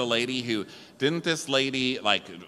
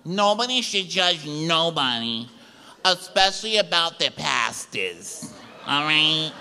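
Teresa; 7 semitones higher than usual. Acted. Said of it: "She's like".

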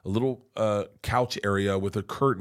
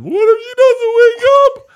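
loud, very high wailing cry; hammed-up hysterical bawling; mock sobbing; 14 dB louder than usual. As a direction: wail it through exaggerated sobs.

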